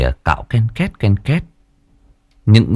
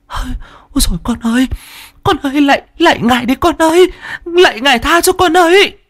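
high panicked wail, rising in pitch; hoảng hốt. A narrator's reading. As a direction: plead frantically for mercy on a grandchild. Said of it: "mà hoảng hốt". (van lạy hoảng hốt, giọng the thé)

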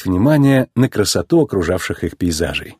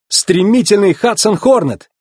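СТРЕМИТЕЛЬНЫЙ said high and proud; high, emphatic billing of a legend; excited proclamation; 9 semitones higher, 3 dB louder than usual. A narrator's reading. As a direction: announce the racer's title eagerly. Proudly naming the racing legend.

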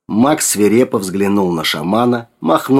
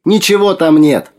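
high, loud answering call; calling back; 9 semitones higher, 5 dB louder than usual. A narrator's reading. Sergei answers Max from beyond the lens, calling back loudly. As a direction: call it back across a distance.

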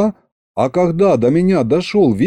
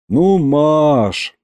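drawn-out, slightly higher plea; exasperated sigh; 4 semitones higher than usual. A narrator's reading. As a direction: sigh it out impatiently. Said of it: "хором вздохнули мы с дедом".

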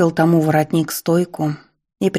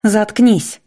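barked; 5 semitones higher than usual. A narrator's reading. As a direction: bark it at him. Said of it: "рявкнула Лана".

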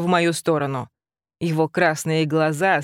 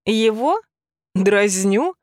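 raised incredulous exclamation; mock surprised cry; 3 semitones higher, slower than usual. A narrator's reading. Heroine mocking Henry's worry, incredulous. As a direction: toss it back in scornful disbelief. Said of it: "вскрикнула громко".

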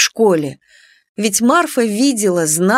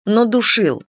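ordinary delivery, nothing marked

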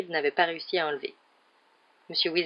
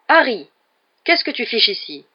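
loud, raised-pitch challenge; startling shout; 5 semitones higher, 7 dB louder than usual. A narrator's reading. Hagrid catches Harry with the witch, loud scolding shout. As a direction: bellow the name, then demand an explanation.

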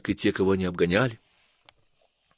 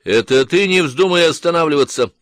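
sharp, raised scolding; angry; 6 semitones higher, 5 dB louder than usual. Acted. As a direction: scold her hard, higher and louder. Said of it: "сердито сказал Жерар".

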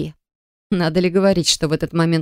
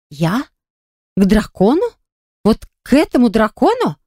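disbelieving; 4 semitones higher than usual. Acted. Heroine shocked by being chosen.

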